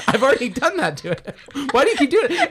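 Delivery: exasperated mock Brad Pitt. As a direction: squeal it through a laugh. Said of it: "He's already".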